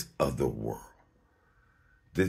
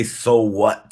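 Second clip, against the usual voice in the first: "so what" shouted much louder, stress on "so"; 4 semitones higher, 10 dB louder than usual. Shown as a demonstration. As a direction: bark "so what" at the thought, loud.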